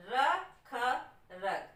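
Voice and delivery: रखरख drawn out very slowly, every syllable with a full अ sound; slow syllable demo. Shown as a demonstration. Teacher showing the अ in रखरख, very slow. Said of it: "अ की आवाज आ रही है".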